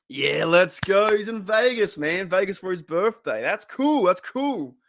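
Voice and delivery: high, hyped-up celebration; excited cheering. Real, not acted. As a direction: cheer on a mate, pitch jumping up. Host cheers viewer's Vegas birthday.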